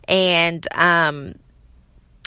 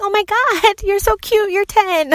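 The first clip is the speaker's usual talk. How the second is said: much higher, giggly gush; 14 semitones higher than usual. Retold or imitated. Mom replays gushing at the helpful ten-year-old, high and laughing. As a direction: coo over how adorable he is. Her gushing reaction to the kid.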